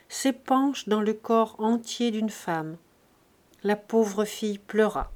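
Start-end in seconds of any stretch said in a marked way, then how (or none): none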